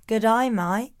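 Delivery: quick try at an Aussie greeting; Aussie accent. Shown as a demonstration. Co-host tries out the accent hack, Aussie accent. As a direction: say it like an Aussie "g'day mate". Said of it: "From an Aussie accent, just say".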